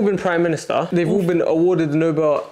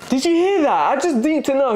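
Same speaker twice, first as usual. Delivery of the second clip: incredulous; 10 semitones higher than usual. Real, not acted. Mocking the friend's answer.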